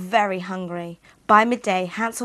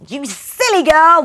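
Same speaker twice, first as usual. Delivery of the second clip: loud, higher scolding witch; angry witch; 8 semitones higher, 13 dB louder than usual. Acted. The witch scolds Gretel angrily, loud and high. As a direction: snap at the silly girl. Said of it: "the witch said angrily".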